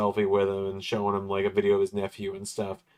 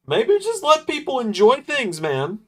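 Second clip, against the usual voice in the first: exasperated; 6 dB louder than usual. Real, not acted. Exasperated rant about ads.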